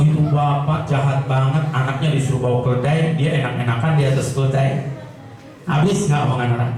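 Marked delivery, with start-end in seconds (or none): 0.1-4.7 lower-voiced mock gossip from the village; gossiping villagers. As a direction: put on a low, scornful villager tone. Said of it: "Kata orang kampung".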